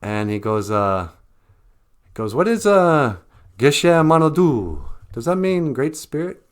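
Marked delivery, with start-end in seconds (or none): 2.3-6.4 French guy. Retold or imitated. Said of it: "I met a guy from France".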